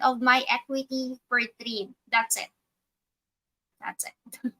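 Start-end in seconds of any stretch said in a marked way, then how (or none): 3.8-4.5 a breathy private aside, almost unheard; whisper. Real, not acted. Trader repeats the point to himself, whispering. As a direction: whisper it to yourself, voiceless.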